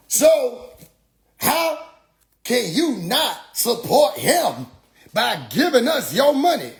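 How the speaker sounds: high, slow, swooping mock preaching; imitated preacher demand. Preacher asking for money, high and drawn-out mock preaching. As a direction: stretch each phrase like a pleading preacher.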